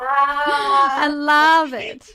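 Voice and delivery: laughing. Real, not acted.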